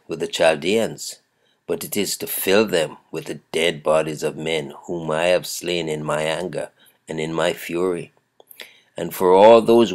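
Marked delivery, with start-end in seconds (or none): none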